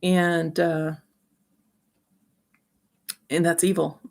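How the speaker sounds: usual speaking voice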